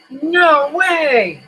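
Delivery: real disbelief. Real, not acted. Finding the freeze-dried jar came unsealed, stunned disbelief. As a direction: blurt it out in real shock. Lid of sealed jar fell off.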